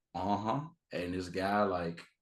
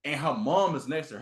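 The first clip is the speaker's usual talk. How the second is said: normal talking voice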